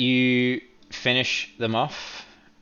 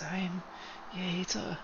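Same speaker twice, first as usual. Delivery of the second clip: frail, higher and much softer voice; weak little whisper, pitched up; whispered reply; 11 semitones higher, 11 dB quieter than usual. Acted. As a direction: answer faintly, high and hushed. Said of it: "a whisper replies".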